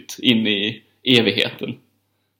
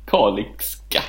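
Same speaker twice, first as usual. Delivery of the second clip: Kalix dialect; 3 semitones lower, 3 dB quieter, much slower than usual. Shown as a demonstration. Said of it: "På kalixka".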